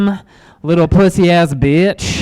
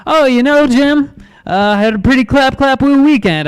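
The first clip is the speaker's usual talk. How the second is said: lightly put-on, higher-pitched chatty voice; mock office small talk; 6 semitones higher than usual. Telling Jim from accounting about the weekend, mock chatty. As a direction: play a chipper reply to a coworker, lifted in pitch. Acts out reply to office coworker.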